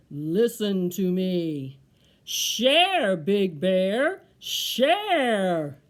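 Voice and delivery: low, slow start swelling to a booming call; deep, loud tree voice. Acted. The tree orders Big Bear to share, deep and loud. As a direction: boom it out like a commanding tree. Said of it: "a deep, low voice said".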